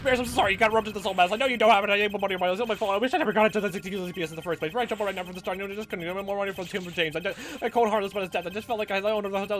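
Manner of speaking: fast, high, furious ranting yell; unhinged shrieking confession; screaming breakdown. Acted. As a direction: let it all explode at once.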